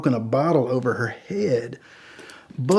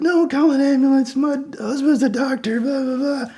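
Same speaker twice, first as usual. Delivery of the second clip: high-pitched mock drunk woman; drunk protest; 12 semitones higher than usual. Drunk woman refusing an ambulance, high whiny imitation. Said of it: "she's really drunk".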